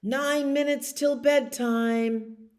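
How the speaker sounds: dad calling the countdown. Acted. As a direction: stretch it out like a dad calling upstairs. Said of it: "And Daddy calls out".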